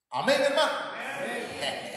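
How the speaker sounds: high, loud call for an amen; pulpit shout. Real, not acted. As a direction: shout it out to rouse the congregation. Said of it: "ya empezó a dar voces".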